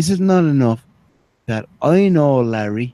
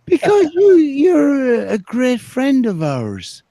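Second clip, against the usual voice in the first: mock crying; 13 semitones higher, slower than usual. Mock tearful praise of friend.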